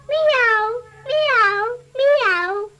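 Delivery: pleading mewing kittens; kitten mews. Acted. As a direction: wail like a sad little kitten. Kittens crying in rhyme.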